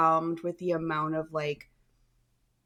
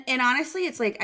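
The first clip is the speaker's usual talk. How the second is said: ordinary delivery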